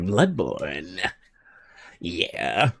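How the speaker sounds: hushed, lip-licking craving; greedy Sonic. Pretend. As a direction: mutter it like rubbing your hands. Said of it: "I'm like that gif of Sonic ... licking his lips".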